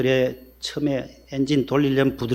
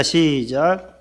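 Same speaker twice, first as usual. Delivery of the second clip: drawn-out cue; much slower than usual. Shown as a demonstration. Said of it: "cue to start".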